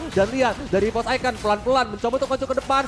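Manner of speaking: loud, high caster call; hyped play-by-play. Real, not acted. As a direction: shout the kill like a caster. (komentar langsung yang berteriak)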